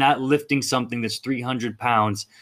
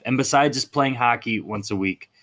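normal talking voice

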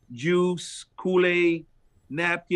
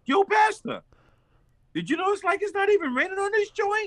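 much higher, amused voice for the neighbor; high-pitched neighbor voice. Retold or imitated. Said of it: "And he said".

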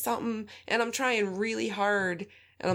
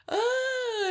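guilty gasp. Retold or imitated. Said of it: "I was like".

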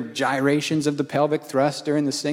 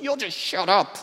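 mock irritation; 4 semitones higher than usual. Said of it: "And I'm like".

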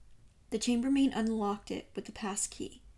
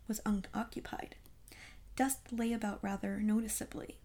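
ordinary delivery

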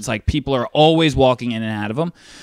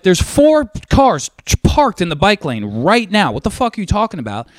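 retold heated arguing; 6 semitones higher than usual. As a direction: hammer out the point in mock outrage. Retold argument with the driver.

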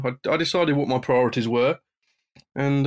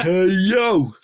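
Scott Hall call; 9 semitones higher, 6 dB louder than usual. Retold or imitated. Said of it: "It sounded like".

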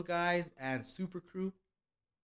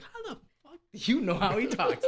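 high-pitched mock disbelief; incredulous challenge; 9 semitones higher than usual. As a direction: replay your baffled comeback, pitched up. Retells incredulous challenge to waitress.

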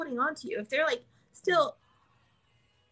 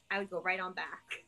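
usual speaking voice

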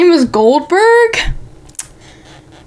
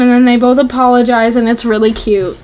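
usual speaking voice

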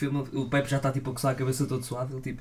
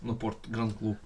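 Ronaldo impression; 6 dB quieter, slower than usual. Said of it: "parece o Luís Franco Bastos a imitar o Ronaldo".

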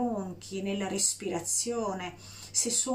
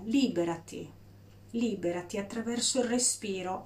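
ordinary delivery

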